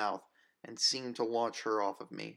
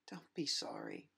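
softer, lower gentle reassurance; tender comfort; 4 semitones lower, 6 dB quieter than usual. Acted. Grace tenderly tells her friend not to be sorry. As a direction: soothe a guilty friend.

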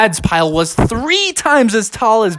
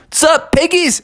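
cheery high greeting; 12 semitones higher than usual. Acted. Bear greets pigs.

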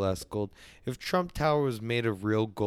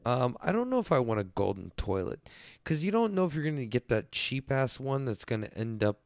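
ordinary delivery

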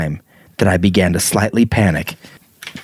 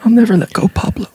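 dramatic movie line; 11 semitones higher, faster than usual. Pretend. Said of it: "ripped his hands off and pushed him out".

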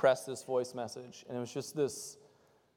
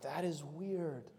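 much softer, slow, flat-toned dismissal; deadpan aside; 8 dB quieter, much slower than usual. Retold or imitated. Pastor mocking harsh theology, a soft slow deadpan. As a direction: drop it quiet and slow, unimpressed. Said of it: "you're like".

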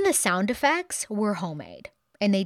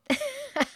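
quick amused laugh, high up; a little burst of laughter at her own joke; bright short chuckle. Real, not acted. Laugh after "Ever heard of it?.

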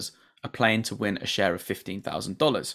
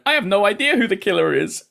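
imitating Bobby; 10 semitones higher, 6 dB louder than usual. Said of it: "And then Bobby's going".

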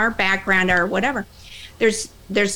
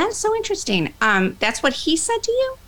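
much higher, wide-eyed mock surprise; mock amazement; 8 semitones higher than usual. Trainer reacting to a trainee's story, higher mock amazement. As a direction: play it up as if truly astonished. Feigned surprise at sexist reason.

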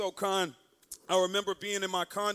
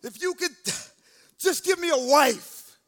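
high, loud tearful pleading; mock crying; 3 semitones higher, 6 dB louder than usual. Young man praying at back of church, mock tearful plea. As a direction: imitate a sobbing prayer, straining upward. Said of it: "I'm crying".

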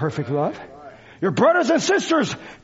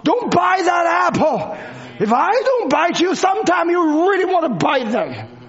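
fiery preaching; 6 semitones higher than usual. Acted. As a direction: preach it out with full force. Preaches at full force.

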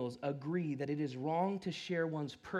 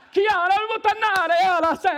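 put-on loud, high laughing Italian accent; 13 semitones higher, 13 dB louder than usual. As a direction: ham up an Italian shout, laughing. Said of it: "That's my Italian accent for you".

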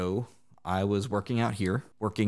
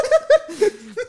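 burst of high laughter; 11 dB louder than usual. Real, not acted. Laughing at own story.

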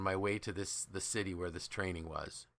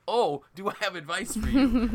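much higher and louder, a laughing, eager burst; amused, eager outburst; gleeful exclamation; 12 semitones higher, 9 dB louder than usual. Retold or imitated. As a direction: pounce on it with delighted glee. Laughing, eager reply to the question.

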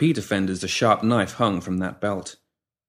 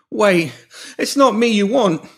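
desperate plea; 12 semitones higher, 5 dB louder, slower than usual. A narrator's reading. Cornered pimp pleading.

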